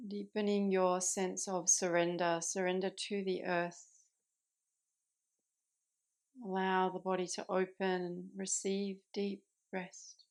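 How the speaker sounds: calm meditation guide with long pauses between phrases; unhurried, settled voice, easing listeners into rest; guided meditation. Acted. Yoga teacher guiding listeners into Yoga Nidra, calm guided meditation.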